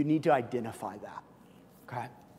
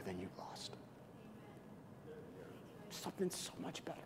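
whispered promise; 3 semitones higher, 21 dB quieter than usual. Acted. Pastor whispering God's promise to congregation. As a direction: breathe it softly.

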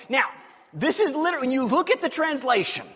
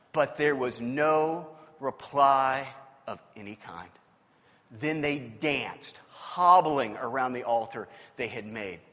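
low, slow scripture narration; scripture reading; 11 semitones lower, 4 dB quieter, slower than usual. A narrator's reading. Preacher reading of Baal's silence, low and slow. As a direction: draw the verse out, low and deliberate. Reads the Bible story aloud.

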